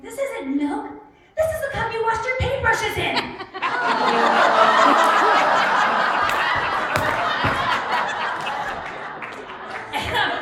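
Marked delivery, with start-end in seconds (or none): 0.0-3.2 high, loud alarmed yell; retold scream. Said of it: "he screams". 3.2-9.9 long breathless wheezing laugh; helpless laughter. Real, not acted. Story punchline, helpless laughter.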